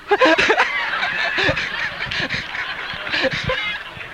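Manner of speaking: high, breathy burst of laughter; big real laugh. Real, not acted. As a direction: crack up at the punchline. Preacher laughs at his own joke.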